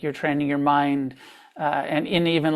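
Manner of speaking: ordinary talk, nothing marked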